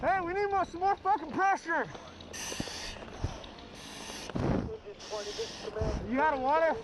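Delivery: very high strained yelling; firefighter shouting through mask. Real, not acted. Calling for water pressure.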